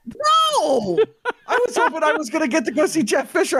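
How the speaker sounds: high, fast, laughing; laughing disappointment. Real, not acted.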